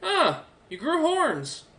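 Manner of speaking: put-on high, slow young man's voice; young soldier. Young soldier noticing Centauri's new horns, high and slow. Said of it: "the young man kinda looks over".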